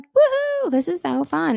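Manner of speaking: put-on squealing high kid's "Woohoo"; gleeful kid. Mom imitating her kids at bath play, high and giddy.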